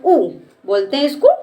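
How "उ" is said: ऊ said brief; short vowel. Shown as a demonstration. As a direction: say ऊ quickly, without holding. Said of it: "इसके उच्चारण में भी कम समय लगता है". (छोटा, कम समय वाला ऊ)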